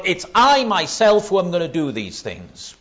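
high, loud punch on "I myself"; forceful divine declaration leaning on "I myself"; emphatic I. Retold or imitated. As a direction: hammer "I myself", then descend. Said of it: "a whole string of I's of the Lord".

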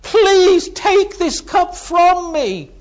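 high, loud, slow anguished plea; voicing Jesus begging the Father in terror, high and loud; desperate pleading. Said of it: "And he's pleading".

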